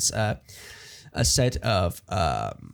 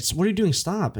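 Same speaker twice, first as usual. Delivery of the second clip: higher, alarmed wife voice; voicing the wife; 8 semitones higher than usual. Friends pitching a scene, wife's alarmed voice. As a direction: mimic the startled wife, pitched up. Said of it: "She's like".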